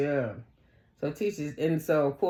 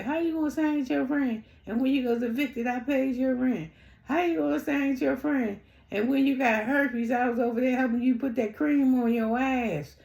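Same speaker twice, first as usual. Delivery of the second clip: high-pitched indignant imitation; hammed-up aggrieved friend, pitched way up; mock indignation; 8 semitones higher than usual. Exaggerated replay of such complaints.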